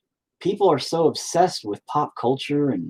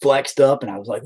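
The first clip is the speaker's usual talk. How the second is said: ordinary delivery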